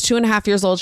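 normal talking voice

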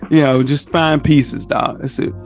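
ordinary delivery

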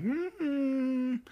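high, doubting hum; a knowing, unconvinced mm-hmm; skeptical mm-hmm. Retold or imitated. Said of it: "I'm like".

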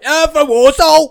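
high, loud, slow hammed-up rallying shout; rally the hometown crowd; mock cheer. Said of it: "How can you go from that?".

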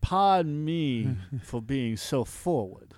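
slow, courtly Southern drawl; Southern gentleman. Acted. Host playing courtly for his laughing co-host, Southern gentleman. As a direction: take it slowly, Southern-gentleman style. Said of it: "going into a Southern Gentleman".

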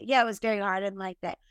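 small sad self. Pretend. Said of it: "give myself a hug and say".

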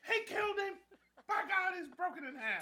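high, hammed-up commentator cry; mock-horrified wrestling call; Jim Ross. Announcer reacts to a brutal hit, mock horror. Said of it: "Jim Ross".

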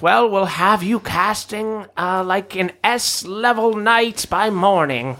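high, excited mad doctor; gleeful scientist, slow and pleased; eccentric doctor voice. Acted.

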